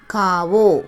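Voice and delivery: காவோ as "kaa-vo", both vowels held; Hindi pronunciation. Shown as a demonstration. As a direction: model காவோ clearly for learners, kaa-vo. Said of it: "அப்படின்னு ஹிந்தியில pronounce பண்ணணும்". (காவோ, இந்தி உச்சரிப்பில் நிதானமாக "கா-வோ")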